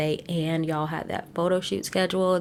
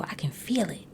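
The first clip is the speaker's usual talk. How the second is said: whisper; 5 dB quieter than usual. Real, not acted. Sudden whisper.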